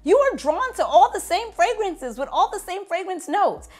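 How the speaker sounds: high, loud sassy calling-out; mock self-reproach. Retold mock scolding of herself.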